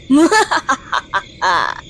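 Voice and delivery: mock menacing laugh; evil vampire laugh. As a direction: give a theatrical villain's laugh to finish the welcome. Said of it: "I am too good at this".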